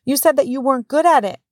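high-pitched imitation of a worried child; protesting little boy. Young son objecting to the nurse, high alarmed protest. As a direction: pipe up high and halt them.

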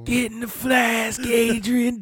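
high, slow put-on voice from the movie; high movie character voice. Quoting Little Nicky to friends in a high put-on voice. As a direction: quote it in a squeaky high voice.

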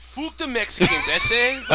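loud, high mock Mexican-Scottish accent; both accents at once. Friend replaying the muddled impression, loud Mexican-Scottish accent. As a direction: ham up a Scottish-Mexican mash-up. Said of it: "He did both. He's like".

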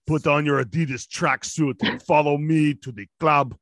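mock-Russian club-goer; bad Russian accent. Co-host inviting everyone clubbing, bad Russian accent. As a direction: lay on a clumsy Soviet Russian accent. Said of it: "I'm really bad with accents".

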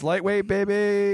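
high, slow bodybuilder shout; drawn-out hype gym call; Ronnie Coleman impression. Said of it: "like Ronnie Coleman's".

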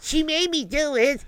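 put-on high whiny kid line; kid excuse. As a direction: blame mom like a caught child. Kid blaming mother.

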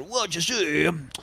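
hammed-up insane street philosopher, hurried in places; Gary Peterson. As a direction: explain nonsense cures like a local eccentric. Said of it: "exactly like everything Gary Peterson ever said".

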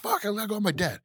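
high-pitched child's cry, imitated; little kid. Retelling the kid running out to his dad, high mock-child cry. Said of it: "And the kid, like, comes out".

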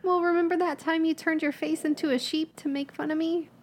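ordinary talk

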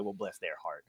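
low, very soft aside; veiled contempt. Retold or imitated. Mall Santa slyly dismissing parents, hushed veiled contempt. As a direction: drop it under the voice, imitating polite disdain. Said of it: "he would kinda hate the parents".

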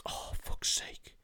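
whispered, slow exasperated sigh of words; soft whispered grumble; under the breath. Retold or imitated. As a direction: whisper it to yourself, annoyed. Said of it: "I'd be like".

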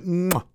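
high pursed-lips mwah kiss sound; a prim little peck noise, lips tight; tight-lipped kiss smack. Retold or imitated. Imitating the date's stiff motherly kiss, a tight-lipped mwah. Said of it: "like, tight-lipped".

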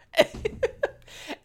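high breathy disgusted laugh. Real, not acted.

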